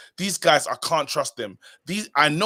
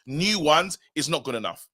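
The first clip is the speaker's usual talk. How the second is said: usual speaking voice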